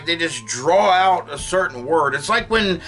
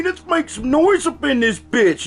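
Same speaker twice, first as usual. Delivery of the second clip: rowdy cheer; 12 semitones higher than usual. Retold or imitated. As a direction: whip up the church crowd. Grandson jumps up in church.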